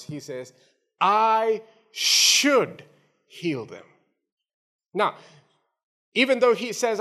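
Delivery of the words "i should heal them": SHOULD held long and set apart; stress on SHOULD. Acted. Preacher quoting God's promise to the congregation, stress on SHOULD. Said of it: "there is an emphasis on that word".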